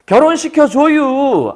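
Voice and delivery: put-on pleading boy. Boy begs father-in-law for marriage.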